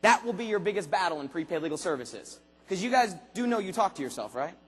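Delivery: normal talking voice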